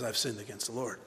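low, hushed admission; simple confession. Acted. David confessing without excuses, quiet admission. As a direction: own up softly, with no excuse.

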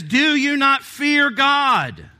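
loud, high, slow rebuke of the other criminal; rebuking shout. Retold or imitated. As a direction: hurl the question at him. Criminal's rebuke, read aloud.

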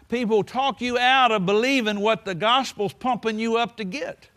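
normal talking voice

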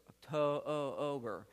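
Octo-o-ober with the o drawn out slowly; Octo-o-ober, long O held; stretched long O. Shown as a demonstration. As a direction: drag out the O in October. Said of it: "That's a long O, like in October".

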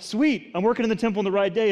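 excited pretend quote. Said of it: "God's presence for Isaiah is not".

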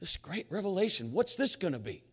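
lightly acted curious anticipation; wondering congregation. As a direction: wonder aloud what is coming. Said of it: "And we're like".

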